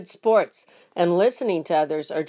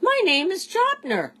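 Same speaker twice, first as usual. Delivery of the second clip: much higher, grinning alien voice; drooling alien; 13 semitones higher than usual. A narrator's reading. Jopner introduces himself to Stanley, high and grinning. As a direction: drool through a silly grin. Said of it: "he drooled and grinned".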